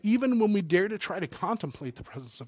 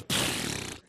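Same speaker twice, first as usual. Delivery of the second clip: long unpitched fizzing burst; spray out a whooshing "pfft"; imitated soda eruption. Preacher mimics Mentos erupting in Diet Coke, explosive hiss. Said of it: "drop a Mentos in a Diet Coke".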